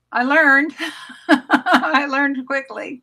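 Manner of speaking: laughing through the words, slowing down. Real, not acted. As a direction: chuckle as you admit it. Laughing at her own naivety.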